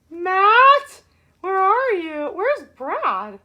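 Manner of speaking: normal talking voice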